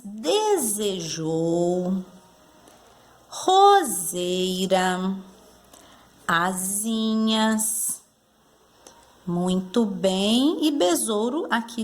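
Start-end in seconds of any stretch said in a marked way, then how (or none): 0.0-8.0 very slow, each syllable stretched; drawn-out words. Shown as a demonstration. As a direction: stretch each word out while underlining it. Marking words with Z sound. (palavras bem esticadas, sílaba por sílaba)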